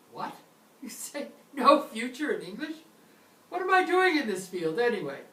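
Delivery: high, loud, laughing disbelief; shocked learner. Pretend. A student reacting to no future tense, shocked and loud. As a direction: blurt it out like a panicked student who's just heard bad news.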